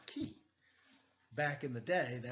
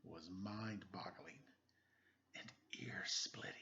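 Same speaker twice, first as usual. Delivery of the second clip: whispered; 7 semitones lower, 8 dB quieter than usual. A narrator's reading. Detective narrating to the listener, hushed whisper. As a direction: breathe it out.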